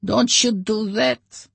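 very high, slow warning; refusing Oleg. A narrator's reading. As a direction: warn him off. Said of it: "Oleg shook his head".

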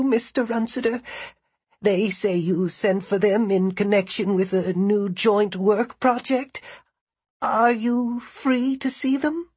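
put-on high, shaky elderly rasp; quaking old woman. A narrator's reading. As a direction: rasp it out like a frail old lady. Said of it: "quaking, elderly voice of Missus Frick rasped".

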